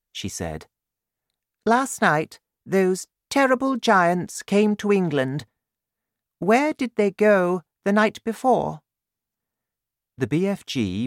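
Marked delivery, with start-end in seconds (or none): 1.6-8.8 high-pitched regal voice; questioning Queen. A narrator's reading. Queen asking the BFG about the giants, high and regal.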